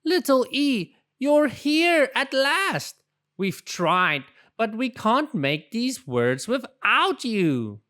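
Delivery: excited storybook chorus voice; cheering. Acted. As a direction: greet him like a long-lost hero. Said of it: "The little letters cheered".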